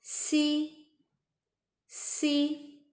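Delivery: "Si" said as the English letter C; high, level "Si" with a long ee. Shown as a demonstration. Teacher showing an easy letter, "Si" like English C. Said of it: "pronounce C letter".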